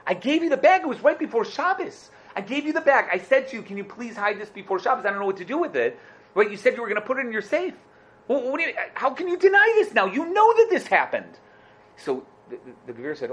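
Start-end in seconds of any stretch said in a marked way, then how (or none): 0.1-11.4 high, indignant acted outburst; raised-voice protest. Rosh Yeshiva insists to his friend he handed over the bag, acted angry protest. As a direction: plead your case with rising outrage. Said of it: "I see you raise your voice".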